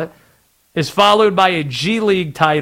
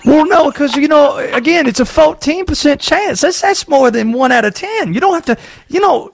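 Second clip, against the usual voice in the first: high, put-on Southern conspiracy voice; Southern accent; 8 semitones higher than usual. Host as Conspiracy Josh teasing the league, in a high put-on Southern accent. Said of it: "where did this accent come from".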